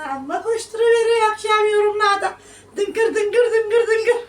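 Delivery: high, loud laughing mockery; mocking laugh. Real, not acted. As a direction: laugh it out at the commenters.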